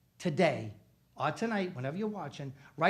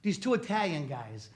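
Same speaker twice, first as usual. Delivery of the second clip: usual speaking voice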